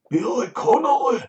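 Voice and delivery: high, loud Scottish accent impression of Billy Connolly. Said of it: "I always go Irish when I try to do Scottish".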